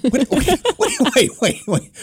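high, laughing "wait, wait". Real, not acted. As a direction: cut in while cracking up. Laughing interruption.

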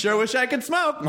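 high, hammy commercial read; mock ad voice. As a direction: sell it like a cheesy ad. Mock Nicorette ad line.

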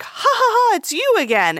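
high-pitched imitated chuckle-greeting; jovial older man. Older man greeting her in the aisle, mock jovial laugh. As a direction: act out his jolly laugh, pitched well up. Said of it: "you know, he's like".

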